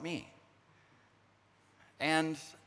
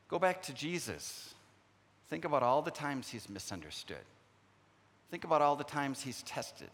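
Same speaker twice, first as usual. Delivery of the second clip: usual speaking voice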